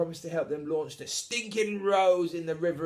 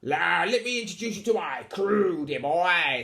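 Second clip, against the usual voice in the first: pirate captain; 5 semitones higher, 6 dB louder than usual. Acted. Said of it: "pirate voice".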